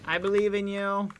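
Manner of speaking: low, loud cheerleader; deep cheering voice. Acted. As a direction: boom encouragement deep.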